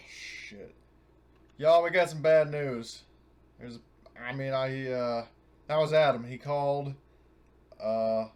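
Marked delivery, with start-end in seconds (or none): 1.6-3.0 loud announcement. Acted. Announcing bad news to crew.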